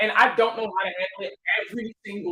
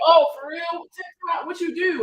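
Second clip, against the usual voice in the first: curious fan; 8 semitones higher, 3 dB louder, slower than usual. Retold or imitated. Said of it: "they be like".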